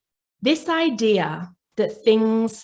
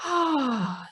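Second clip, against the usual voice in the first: soft, higher breathy oh of relief; relieved sigh; 5 semitones higher, 3 dB quieter than usual. Retold or imitated. Inner child finally seen, soft relieved oh. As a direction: let the breath out as you say oh. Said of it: "they go ... they breathe".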